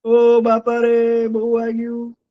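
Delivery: high, slow mock wail of pain; crying donkey. Donkey fell from tree, crying in pain. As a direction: wail like a hurt child donkey. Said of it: "એમ કરીને રડવા માંડે છે".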